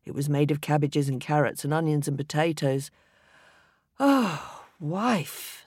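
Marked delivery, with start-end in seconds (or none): 4.0-5.6 sighing. A narrator's reading. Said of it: "sighed Mister Smith".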